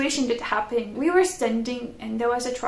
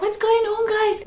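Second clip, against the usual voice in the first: confused; 9 semitones higher, 4 dB louder than usual. Retold or imitated. Disoriented on bus.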